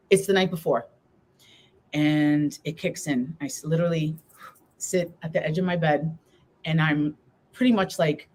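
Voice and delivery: ordinary talk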